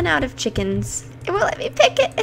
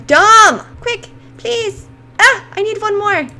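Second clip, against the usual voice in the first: self-scolding yell; 7 semitones higher, 7 dB louder, slower than usual. Real, not acted.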